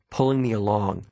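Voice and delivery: usual speaking voice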